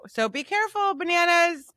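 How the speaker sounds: high, loud singsong warning; mock warning. Co-host warning Bananas on the show, high and loud. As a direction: wag a finger at him in a mocking sing-song. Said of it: "warning Bananas".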